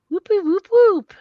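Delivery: high, loud celebratory whoops; gleeful little victory chant; excited whoop. Real, not acted. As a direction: cheer it like a win. Celebrating TikTok views.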